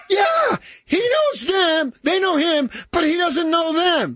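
very high, drawn-out shouted imitation; mother's scream. Mother repeats herself to dense father, imitated screaming. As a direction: holler it slowly across the room. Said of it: "screams across the opposite end".

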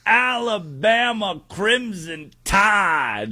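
high, drawn-out hype shout; Pat McAfee. Acted. Host introducing Alabama to listeners, as Pat McAfee. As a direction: belt out each word slowly like a big game-day intro. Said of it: "my best Pat McAfee impression".

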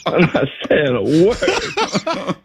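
slow, laughing through the words; a real laugh breaks each word. Real, not acted. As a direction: fight back laughter as you say it.

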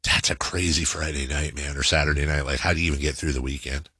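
low, quick musing aside; imagined listener's remark. Retold or imitated. Listener wondering about the friend's drinking, low musing. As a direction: voice a skeptical listener, low and hurried. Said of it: "you might say to yourself".

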